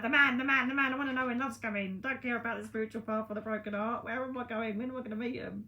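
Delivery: hammed-up impatient lover; impatient seekers. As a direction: play the love-hungry client begging to know. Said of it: "everybody's like".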